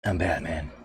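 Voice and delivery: low, hushed superhero voice; Batman. Acted. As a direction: drop it low and quiet, Batman-style. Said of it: "He's Lego Batman".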